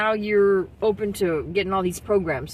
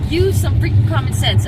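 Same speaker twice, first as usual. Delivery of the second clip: loud, raised-pitch outburst; real fed-up demand, much louder; exasperated; 5 semitones higher, 9 dB louder than usual. Real, not acted. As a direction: belt it out in frustration. Exasperated plea during rant.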